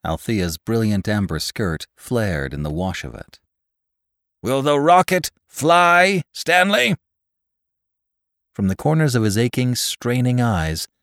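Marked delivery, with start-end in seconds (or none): 4.4-7.0 high, loud shouted question; booming demand. A narrator's reading. Voice from the sky demands the rocket's fate, booming. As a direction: call down forcefully, rising high. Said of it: "The voice from the sky fell on him".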